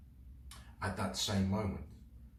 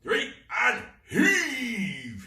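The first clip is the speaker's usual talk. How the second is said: high, loud pirate roar; 10 dB louder, much slower than usual. Acted. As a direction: roar the count to the crew. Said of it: "he roared".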